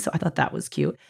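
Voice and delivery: ordinary delivery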